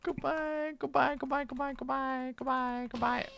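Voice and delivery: rapid string of squeaky-high goodbyes; high-pitched farewell. Pretend. Seeing a friend off the stream, high-pitched playful farewell. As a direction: repeat it high, like a little chant.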